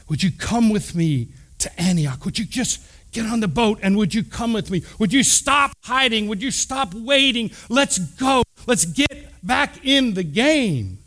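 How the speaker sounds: building from even appeal to high-pitched rallying call; urgent pleading. Retold or imitated. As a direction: urge him on, pitch climbing, slowing on the last line. Barnabas urging Saul to return.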